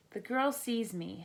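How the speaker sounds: plain reading voice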